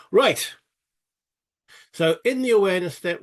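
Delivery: ordinary talk, nothing marked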